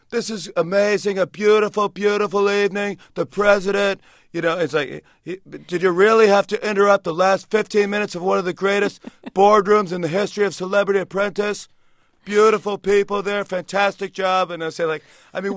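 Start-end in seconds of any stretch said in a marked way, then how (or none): 0.1-4.0 higher, louder boastful Trump voice; brash showman bragging, pitched up and loud; Donald Trump impression. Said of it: "I did a Donald Trump impression". 5.5-14.5 higher, louder boastful Trump voice; brash showman bragging, pitched up and loud; Donald Trump impression. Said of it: "I did a Donald Trump impression".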